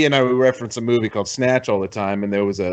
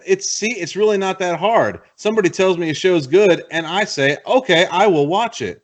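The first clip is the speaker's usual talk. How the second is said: raised pitch, louder mock lecture; patiently exasperated, pitched up; teasing scolding; 7 semitones higher, 3 dB louder than usual. Real, not acted. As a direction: lecture a stubborn friend in a high, insistent voice. Mock lecture to friend Joseph.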